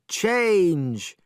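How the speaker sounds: a sharp shouted spell; casting a spell with a shout; shouted command. Acted. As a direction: call out the magic word. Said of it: "she shouted".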